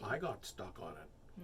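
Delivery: low, soft, slow excuse; deadpan repetition. Pretend. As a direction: repeat it quietly like a stuck record. Stuck-record repetition of excuse.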